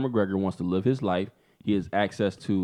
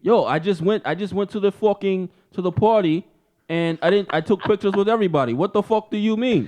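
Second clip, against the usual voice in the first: higher, agitated put-on husband voice; defensive Conor; 7 semitones higher than usual. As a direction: protest innocence in a mock quarrel. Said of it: "you Connor's wife".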